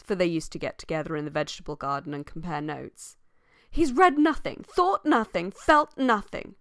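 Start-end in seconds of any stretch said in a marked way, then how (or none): 3.7-6.6 high, loud, carrying condemnation; very emphatic. Acted. Sally condemning Hugh in the garden, very emphatic. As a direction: hammer each phrase, drawing out the last. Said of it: "in that very emphatic voice which carried".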